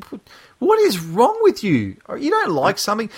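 high-pitched incredulous challenge; exasperated disbelief. Retold or imitated. Podcaster retelling his reaction to obsessive fans, exasperated.

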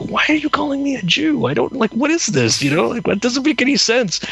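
high-pitched retold indignation; imitating an upset kid's complaint; berating. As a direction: scold a parent in exasperated, rising protest. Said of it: "I berated my mom about it".

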